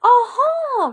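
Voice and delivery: high happy "Aha!"; joyful cry. A narrator's reading. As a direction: burst out with delight. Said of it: "cried Grandmother".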